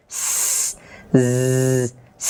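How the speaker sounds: long sharp hissed স and buzzed জ; whistling bird-like sibilants. Shown as a demonstration. Teacher imitating a bird's far-carrying sound, long sharp sibilants. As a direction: hold each sibilant like a piercing bird call. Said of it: "যেগুলোর আওয়াজ হচ্ছে".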